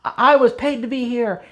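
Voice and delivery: half-hammed angry outcry; mad protest. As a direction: protest to the crowd that it was rigged. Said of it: "that makes him mad".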